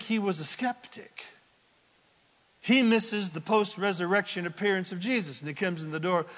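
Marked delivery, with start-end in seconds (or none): none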